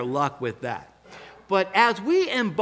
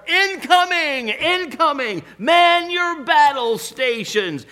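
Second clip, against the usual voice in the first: loud, high-pitched holler to the troops; hollered battle alarm; 9 semitones higher, 6 dB louder than usual. Retold or imitated. Kids warning of a snowball bomb, hollered high and loud. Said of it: "we'd holler".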